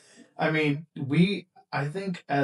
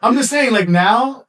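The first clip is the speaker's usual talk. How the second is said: laughing; 7 semitones higher, 11 dB louder than usual. Real, not acted. Flustered denial, laughing it off.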